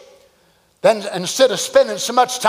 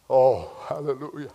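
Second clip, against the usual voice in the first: normal talking voice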